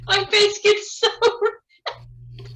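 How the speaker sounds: high, slow squeal of laughter; helplessly giggly; real laughing. Real, not acted. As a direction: force words out between laughs. Still cracking up at the video.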